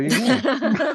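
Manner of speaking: chuckling through the punchline; laughing. Real, not acted. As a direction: laugh it out, slow and high. Joking after claiming he bribed.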